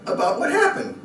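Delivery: normal talking voice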